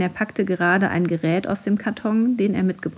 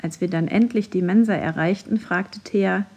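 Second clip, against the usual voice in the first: ordinary delivery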